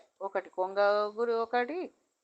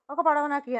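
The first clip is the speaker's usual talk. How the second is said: higher, louder acted demand; insistent demand; 6 semitones higher, 6 dB louder than usual. Old woman claims a boat from the trader, demanding. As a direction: press the claim loudly, pitch raised.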